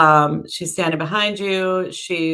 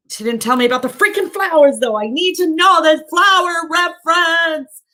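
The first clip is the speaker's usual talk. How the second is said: loud, obnoxious; 9 semitones higher, 3 dB louder than usual. Real, not acted. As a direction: sing out a playful, insistent complaint. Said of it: "likes me to be loud".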